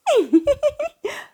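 very high, quick giggle; high tittering laugh; tiny fairy giggle. Acted. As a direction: giggle like a tiny hidden creature. Hidden fairies giggle.

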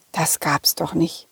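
ordinary delivery, nothing marked

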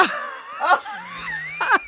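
high shrieking laugh; burst of laughter. Real, not acted. Laughs at own childhood wish.

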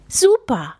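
put-on mild happy call of a young boy; lightly voiced child exclaiming. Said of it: "ruft Theo".

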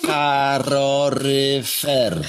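slow, drawn-out separate syllables; sylabizowanie. Shown as a demonstration. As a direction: stretch each syllable of "domek" and "karoryfer". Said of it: "otwieramy na sylabę". (przeciągane sylaby, powoli)

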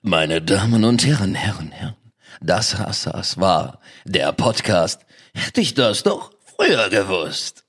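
slow, low announcer voice; radio announcer. Pretend. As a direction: announce the podcast like a radio host. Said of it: "Mach noch mal ein Intro".

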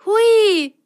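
high, loud whee; delighted cry. Acted. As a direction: squeal with joy. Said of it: "rief Rosenrot".

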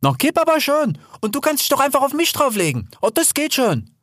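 high, lively reassurance; breezy Hel the boar. Acted. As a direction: brush off the objection in a high voice. Hel waves away the objection. (unbekümmert, mit hoher Stimme)

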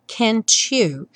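can't you said "cantchu"; T becomes tch. Shown as a demonstration. Teacher shows can't you sounding like cantchu. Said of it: "esse T virar tch".

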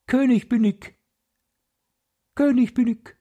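very high, piercing little bird voice; a tiny bird shrilling its claim from the sky; fine piercing voice. Acted. Wren calls down to the birds, very high and piercing. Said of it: "mit feiner, durchdringender Stimme".

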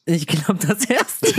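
high, giggling answer; stifled giggles. Real, not acted. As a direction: laugh through the answer. Answers while laughing.

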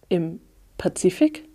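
slow, rising, disbelieving question; incredulous echo. A narrator's reading. Newcomer learns the island's location, incredulous. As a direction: repeat it as if stunned.

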